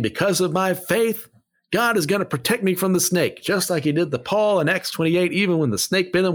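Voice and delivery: mocking believer. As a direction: parody the snake handler's confident boast. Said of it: "picking them up and saying".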